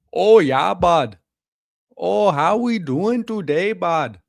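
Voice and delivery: loud, high, hammed-up northern Canadian; thick Canadian accent. Streamer imitating people in northern B C, thick Canadian accent. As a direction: greet a buddy in a slow, exaggerated Canadian accent. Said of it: "their accents get so Canadian".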